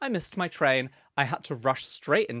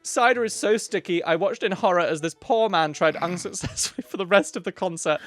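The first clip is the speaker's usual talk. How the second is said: put-on higher, louder, giggling old lady read; hammed-up old auntie through laughter; elderly lady voice, laughing; 7 semitones higher, 8 dB louder than usual. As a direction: read it grand and high while cracking up. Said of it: "my elderly lady accent".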